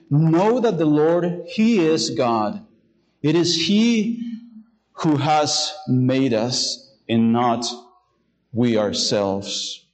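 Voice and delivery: reading "Know that the Lord, he is God" very slowly, with long pauses between phrases; slow scripture reading. Shown as a demonstration. Pastor reading verse three to his congregation, slow and weighty. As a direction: draw each phrase of the verse out slowly, letting every pause sit. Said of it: "in verse three".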